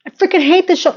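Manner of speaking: high, heavier emphasis on hate; stronger vent. Real, not acted. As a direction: lean harder into it. Venting again stronger.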